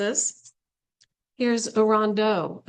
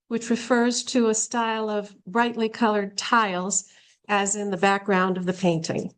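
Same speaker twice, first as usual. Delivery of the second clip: ordinary delivery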